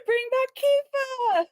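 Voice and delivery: high, loud, slow plea; long high drawn-out plea; pleading whine. Real, not acted. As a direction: wail it like a desperate fan. Pleading for the old boyfriend.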